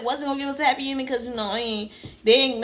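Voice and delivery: ordinary talk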